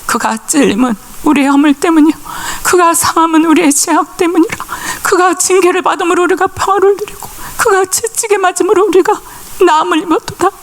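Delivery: put-on pastor's wife proclaiming Isaiah over the old woman, solemn; proclaiming scripture. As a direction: declare the verse over the knee. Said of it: "이 말씀 선-, 선포하고요".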